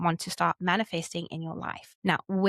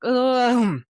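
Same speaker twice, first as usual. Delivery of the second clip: frustrated groan; 4 semitones higher, 6 dB louder than usual. Retold or imitated. Moment of frustration before clarity.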